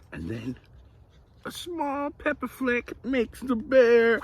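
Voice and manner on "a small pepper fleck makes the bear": high, slow, teetering on a sneeze; suspenseful story build-up. A narrator's reading. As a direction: stretch it out high, swelling to the last word.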